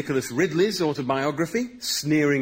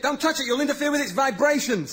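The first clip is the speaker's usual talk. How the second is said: high loud frantic warning; panicked shout; 11 semitones higher, 4 dB louder than usual. Acted. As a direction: yelp it in alarm. Frantic warning about crystal.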